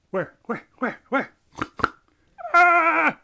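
sudden loud high gasp; startled yelp. Acted. Bird yelping as Helmet Pig appears. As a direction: jump at the sight.

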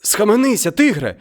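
high, loud cry of alarm; man crying out as the tiger pounces; frightened cry. Acted. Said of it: "зойкнув чоловік".

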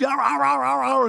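gruff imitation of a cattle dog barking at the arguing couple; mock dog barking. Cattle dog barking in the ute, mock bark.

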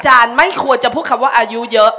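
loud playful telling-off; mock scolding. Real, not acted. Playfully scolding the host for calling her old, loud. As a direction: scold him loudly, half joking.

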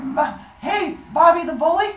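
high-pitched, loud mock brag; mock boasting. Son mocking his mom's plan to face bullies, mock boasting. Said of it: "go like".

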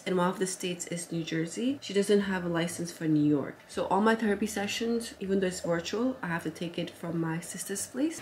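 ordinary talk

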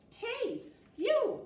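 sudden high call, then a loud shout on you; a playful teacher shouting hey you at the kids. Acted. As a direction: hail someone across the room, loud. Calling out to a listener.

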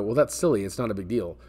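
usual speaking voice

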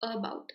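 About opening on 'uh'; schwa start. Shown as a demonstration. Teacher models the first sound, About starting with schwa. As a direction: say About as 'uh-bout'. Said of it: "It starts with the a sound".

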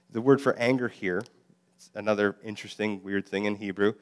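ordinary talk, nothing marked

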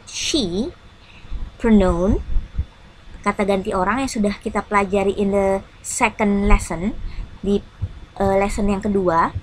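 normal talking voice

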